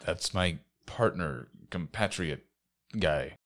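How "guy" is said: normal talking voice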